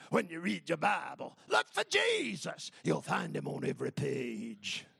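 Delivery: put-on old preacher's gravel growl; gravelly voice. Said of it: "he had that gravelly voice".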